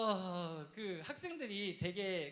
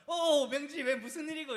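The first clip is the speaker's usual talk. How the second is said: forced bright greeting, higher and louder; putting on cheer; 8 semitones higher, 10 dB louder than usual. Retold or imitated. Boy hiding his failure from a friend, forced cheerful greeting. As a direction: act carefree to save face. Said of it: "밝은 표정을 지으면서".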